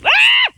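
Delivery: very high, loud thrilled shriek; high loud squeal of sledding glee; excited shriek. Real, not acted. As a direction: let out a delighted scream as the sled flies. Sled takes off fast.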